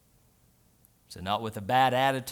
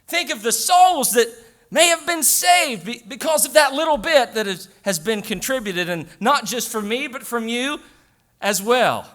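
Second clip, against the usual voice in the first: high, raised voice with stretched emphatic phrases; full-force preaching; 8 semitones higher, 7 dB louder than usual. Real, not acted. Pastor telling the congregation it belongs to God, preaching hard. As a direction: drive it home to the congregation.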